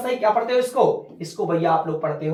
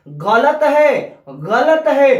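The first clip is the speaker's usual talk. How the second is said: emphatic; 4 semitones higher, 5 dB louder, much slower than usual. Acted. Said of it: "insisting it is wrong".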